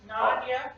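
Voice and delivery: "Nadia" said carefully as the name's owner says it; corrected pronunciation. Shown as a demonstration. Speaker correcting the official on their name, corrected pronunciation. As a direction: show how "Nadia" is pronounced. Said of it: "It's pronounced".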